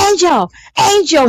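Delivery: high mock yell; retold scream. Waking her sleeping co-host over Skype, high mock scream. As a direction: imitate calling out to wake someone. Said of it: "So I'm screaming".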